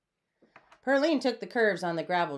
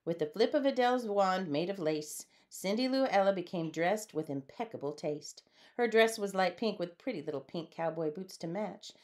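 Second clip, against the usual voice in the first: plain reading voice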